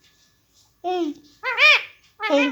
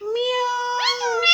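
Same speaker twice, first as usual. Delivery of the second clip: cat meow; 4 dB quieter, much slower than usual. Shown as a demonstration. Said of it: "फिर से try करते हैं।".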